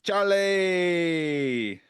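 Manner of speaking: high, drawn-out happy call; delighted greeting. Acted. Dave greeting his old friend Charlie, delighted call. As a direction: call out her name with joy. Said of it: "Dave is like".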